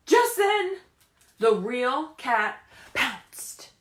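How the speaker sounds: lively, sing-song picture-book reading; animated storybook voice with questions to the kids; reading to children. Acted. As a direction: read it like story time for little ones. Teacher reads picture book aloud.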